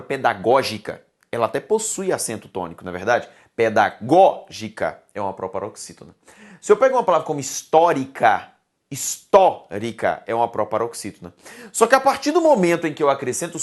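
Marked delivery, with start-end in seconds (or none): 3.5-5.0 "Pedagógica" broken up, with GÓ punched; stress on GÓ. Shown as a demonstration. Teacher showing students the tonic syllable, stressing GÓ in "Pedagógica". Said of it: "deixa de ser em pedagógica, gó". 8.9-10.2 "histórica" broken up, with TÓ punched; stress on TÓ. Shown as a demonstration. Teacher showing students the tonic syllable, stressing TÓ in "histórica". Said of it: "o tó".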